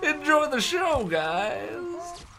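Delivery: upbeat red carpet host wrap-up; gushing red carpet chatter. Acted. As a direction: sign off like an entertainment reporter.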